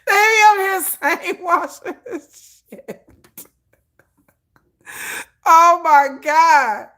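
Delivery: high, slow, laughing almost to tears. Real, not acted. Storyteller laughing at sane-washing, high and slow. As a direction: crack up until it hurts. Laughing at absurd assistant talk.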